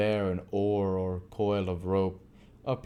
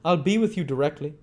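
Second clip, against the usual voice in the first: raised, higher call; 8 semitones higher, 6 dB louder, faster than usual. A narrator's reading. Man at the window telling the couple to wait, raised call. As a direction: call it down to the visitors. Same voice calling down.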